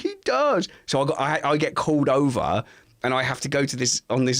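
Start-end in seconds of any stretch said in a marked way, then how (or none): 0.0-0.7 put-on high, flat-out insistent child; insist, a stubborn little girl; sulky daughter. Daughter insisting dad looks bad.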